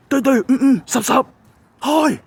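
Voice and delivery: high, loud silly greeting voice; goofy sign-off. Acted. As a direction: squeak a playful goodbye.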